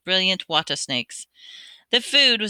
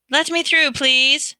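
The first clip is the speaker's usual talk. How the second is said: bossy call; 8 semitones higher, 6 dB louder, slower than usual. A narrator's reading. Percy pushing through crowd.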